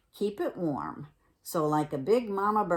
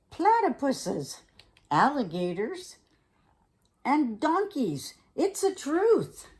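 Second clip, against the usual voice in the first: higher and louder word by word; building sales pitch; 8 semitones higher, 5 dB louder than usual. Acted. Fox pushes the egg on Bear, rising excited pitch.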